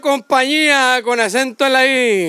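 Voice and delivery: slow declaimed verse with stretched line endings; mock poem recital. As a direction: declaim it like a grand comic bard. (declamación poética cómica, alargando los finales)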